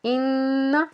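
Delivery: इन with the n held as a nasal hum; इन, humming on a long n; ghunnah. Shown as a demonstration. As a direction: draw out the doubled noon in इन through the nose. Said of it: "इसको हम गुन्ना के साथ पढ़ते हैं".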